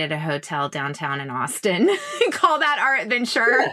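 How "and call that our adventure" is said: laughing. Real, not acted. Laughing at own cheap trips.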